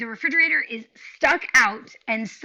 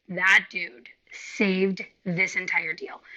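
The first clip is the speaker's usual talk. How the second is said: slow, stretched praise; drawn-out grateful praise; slow emphasis; 3 semitones lower, much slower than usual. Real, not acted. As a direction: lean on every word in admiration. Praising the agent.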